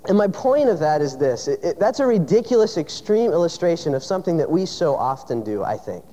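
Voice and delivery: ordinary talk, nothing marked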